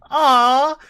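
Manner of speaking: high, loud cooing aww; mock aww. Reacting to the puppy, a loud high coo. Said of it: "I had three emotions".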